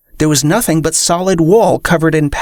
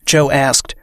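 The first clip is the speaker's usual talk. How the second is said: plain reading voice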